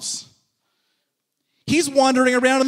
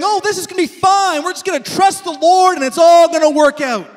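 mock carefree; 4 semitones higher, 6 dB louder than usual. Mock carefree quote of Adam and Eve.

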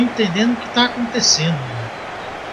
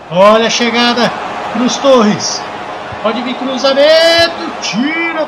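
play-by-play commentary; slower than usual. Acted. Live football play-by-play.

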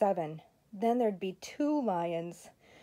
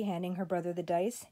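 ordinary delivery

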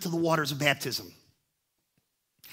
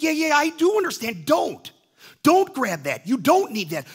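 high, loud insistence; firm rebuke; 9 semitones higher, 7 dB louder than usual. Retold or imitated. God insisting to the preacher, loud and firm. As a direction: shut the argument down. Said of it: "And he's like".

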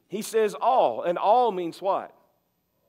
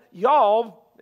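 "Y'all" punched louder, Southern drawled vowel; 6 dB louder than usual. Retold or imitated. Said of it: "that's Southern".

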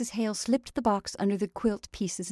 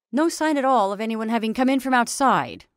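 loud, businesslike official, pitch raised; resuming interrupted conversation; 5 semitones higher, 10 dB louder than usual. A narrator's reading. Attorney resumes his talk with the sheriff, businesslike. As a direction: pick up loudly mid-conversation. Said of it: "in the manner of continuing an interrupted conversation".

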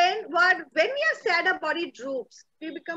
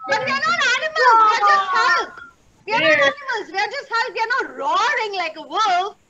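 high, loud, urgent rallying call; excited call; 5 semitones higher, 4 dB louder, faster than usual. Shown as a demonstration. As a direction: rouse the class into Hulk mode. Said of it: "leading class to act Hulk".

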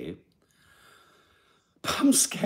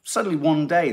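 ordinary talk, nothing marked

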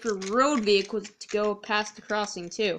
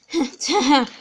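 cheeky jeering laugh, pitched up; mocking truck laughter; 5 semitones higher, 5 dB louder than usual. Trucks jeering at Toby as they push him, mocking laugh. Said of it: "the trucks laughed and laughed".

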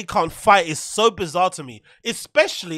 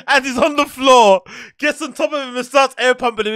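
gleeful excitement; 4 semitones higher, 5 dB louder than usual. Real, not acted. Laughing at imagined humiliation.

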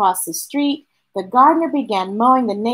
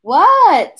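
calling back; 7 semitones higher, 6 dB louder than usual. Acted. Mama answers a call.

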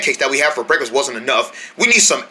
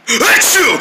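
loud fake sneeze; 6 semitones higher, 3 dB louder than usual. Pretend sneeze in hiding.

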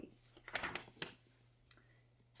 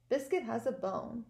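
plain reading voice

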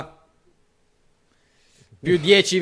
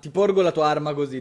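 usual speaking voice

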